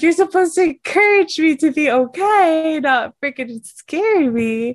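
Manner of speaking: high, loud mock outrage; indignant squeal. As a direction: protest at him half laughing. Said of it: "I was like".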